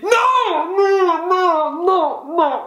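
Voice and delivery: high, wailed no after no; dismayed refusal. Real, not acted. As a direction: protest a crushing result. Gets an unwanted quiz result.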